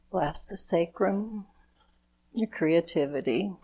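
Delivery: calm, measured blessing prayer; gentle ceremonial invocation; blessing ritual. Acted. Leads a chakra blessing ritual.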